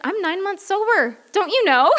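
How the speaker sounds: hammed-up indignant whine at her sister; indignant self-quote. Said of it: "And I was like".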